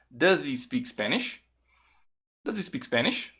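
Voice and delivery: "Does he" blended into "duh-zee"; linked, silent H. Shown as a demonstration. Teacher shows how the question sounds, H silent in "Does he". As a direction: keep only the "ee" of "he" in "Does he speak Spanish". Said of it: "o som da vogal é I. Então vai ficar".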